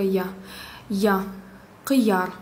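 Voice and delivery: teacher's careful demo of «я»; Russian-style я. Shown as a demonstration. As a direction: open ya in «Я», «кияр». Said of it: "как русское".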